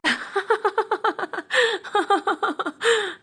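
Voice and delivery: high mocking burst of laughter. Valli laughs at Sivakami's dream fears, high mocking laughter. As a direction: laugh out at her silly fear.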